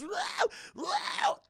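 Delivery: very high wailing cry; zombie wail. Retold or imitated. Zombie wife coming back to life, shrill wail. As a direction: shriek like a reborn zombie.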